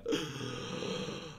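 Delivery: high, drawn-out gasp of relief; long mock gasp. Student melting to the floor in relief, long mock gasp.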